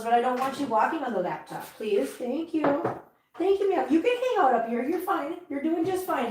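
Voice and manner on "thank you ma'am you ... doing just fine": gentle pet talk. Real, not acted. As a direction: reassure the kitty, high and light. Reassuring a cat.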